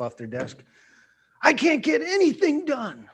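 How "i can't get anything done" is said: mock frustrated cry, much higher and louder; angry outburst. Worker snapping while clearing the desk, angry outburst. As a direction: blow up over nothing, pitched way up. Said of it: "they're reacting angry towards something else".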